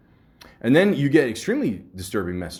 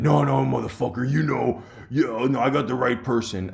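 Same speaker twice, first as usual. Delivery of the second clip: agitated caller; 5 semitones higher, 4 dB louder than usual. Retold or imitated. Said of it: "and then he said".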